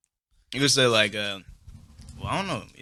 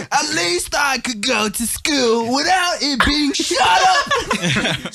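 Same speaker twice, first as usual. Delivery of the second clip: high, slow British parody, laughing; mock British accent; 10 semitones higher, much slower than usual. Mocking British memes about school, hammed-up British accent. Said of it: "how British people talk".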